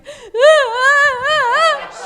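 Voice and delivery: loud, high hammed-up wail; exaggerated sobbing howl; mock bawling. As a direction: wail like a comic crybaby. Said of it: "I'm bawling. I'm crying".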